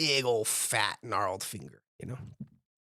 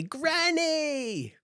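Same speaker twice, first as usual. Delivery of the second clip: a high, ringing shout of "Granny!"; loud call. Acted. Jinx calling out to his granny, a high ringing shout. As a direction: call out to her across the room, pitched way up. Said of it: "really loud".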